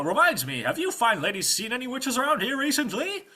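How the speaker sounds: high, loud gallant questioning; pompous knight. Acted. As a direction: ask the ladies grandly and obliviously.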